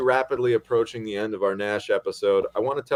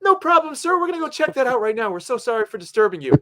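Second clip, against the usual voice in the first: much higher, fast apologetic voice; meek guard; 11 semitones higher, faster than usual. Retold or imitated. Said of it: "this guy's just like".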